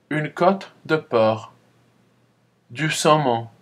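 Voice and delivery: spaced teacher model; model repetition. Shown as a demonstration. Teacher listing dishes for the class to repeat. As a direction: give each word a pause for echo. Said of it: "Alors, répétez après moi".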